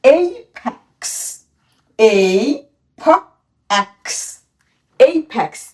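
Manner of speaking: এইপ্যাক্স stretched out very slowly; teacher modelling a long word slowly; slow drilled pronunciation. Shown as a demonstration. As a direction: draw out each part of এইপ্যাক্স. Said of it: "এটির উচ্চারণ কি হবে".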